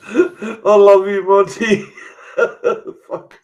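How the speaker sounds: high, drawn-out, tearful with laughter; helpless laughter. Real, not acted. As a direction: squeeze out affection while crying with laughter. Helpless laughter at friend's joke.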